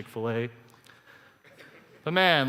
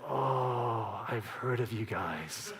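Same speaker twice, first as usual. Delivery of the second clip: slow, lowered, drawn-out recognition; long wary oh, then softer and lower; knowing realization; 4 semitones lower, 5 dB quieter, much slower than usual. Retold or imitated. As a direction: act it dawning on you who these people are. Retells wary dawning realization.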